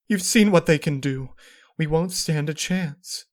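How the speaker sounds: high, loud, trembling urgency; frightened plea. Acted. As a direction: beg in fear. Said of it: "Luna trembled".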